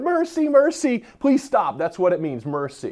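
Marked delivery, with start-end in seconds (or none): 0.0-1.8 mock whining plea. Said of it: "I would be whining and crying, going".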